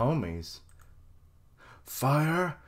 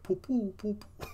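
high soft puffing poo sounds; mock fireball noises; 11 semitones higher, 4 dB quieter than usual. Mocking Prometheus's weak fireballs, high puffs. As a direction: imitate little fireballs shooting. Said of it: "He's like".

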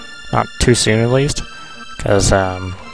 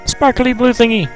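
character voice; 9 semitones higher than usual. Acted. Voicing a character's line.

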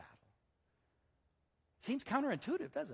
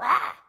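sudden loud, high "Ah!"; mock menacing yell; 10 semitones higher, 18 dB louder than usual. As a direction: burst out to scare off a dog. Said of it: "and I'd go".

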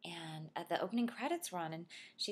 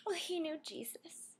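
put-on much higher little-girl voice; high-pitched mock child voice; young daughter retorting; 11 semitones higher than usual. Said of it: "and then she said".

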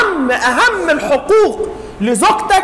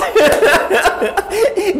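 hearty laugh; 6 semitones higher than usual. Real, not acted. Speaker laughs.

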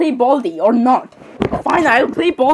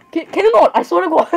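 higher, louder outburst with a laugh; too loud; 6 semitones higher than usual. Real, not acted. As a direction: yell it half laughing. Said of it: "I think that was a bit too loud".

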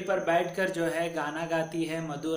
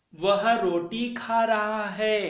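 slow, higher and louder, stressing खा; slow emphatic recitation; 4 semitones higher, 4 dB louder, slower than usual. Shown as a demonstration. Teacher recites again slowly and loudly for pupils. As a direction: lift the voice and stretch each word. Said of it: "फिर से एक बार उच्चारण करेंगे".

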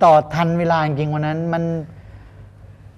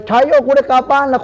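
high, loud cry of relief; retold shout of joy; 8 semitones higher, 4 dB louder than usual. Man retelling to the interviewer how he shouted with joy, loud and high. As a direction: shout it like you just got saved. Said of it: "ผมตะโกนผมตะโกนเลย".

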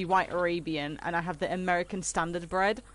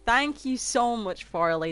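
normal talking voice

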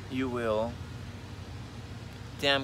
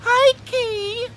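excited squeal; 7 dB louder than usual. Real, not acted. High loud greeting.

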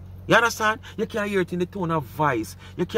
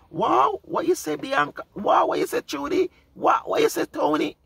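much higher mock gushing over each viewer comment; mocking fake surprise; 11 semitones higher than usual. Mocking the woman as she pretends to read out comments, much higher fake gushing. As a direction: ham up her fake amazement at comments that aren't there. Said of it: "And everything where she say".

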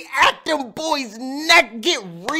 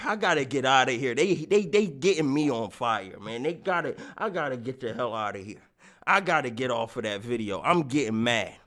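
annoyed low mutter; 11 semitones lower, 7 dB quieter, faster than usual. Real, not acted. Leaving annoyed.

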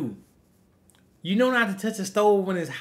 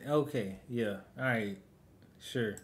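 low, very soft, unconvinced; dismissive acceptance; 7 semitones lower, 10 dB quieter than usual. Real, not acted. Reactor doubting a sudden apology, dismissive and muted. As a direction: drop right down, as if brushing off an apology.

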